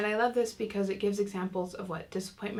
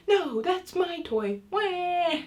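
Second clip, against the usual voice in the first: high, slow mock-tantrum cry over a toy; high-pitched angry wail; 10 semitones higher, 4 dB louder, much slower than usual. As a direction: imitate a small child's drawn-out toy tantrum. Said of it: "it gets so high-pitched".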